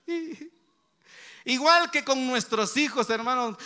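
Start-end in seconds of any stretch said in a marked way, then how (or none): none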